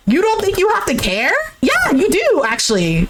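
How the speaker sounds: high-pitched incredulous question and retort; indignant challenge. Pretend. Host scolds the ex about having to care, high and indignant. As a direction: pitch it right up, answering your own question. Host argues with man on screen.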